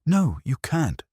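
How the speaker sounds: high, desperate protest; a high pleading cry. A narrator's reading. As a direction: beg them to take it back. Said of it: "she cried".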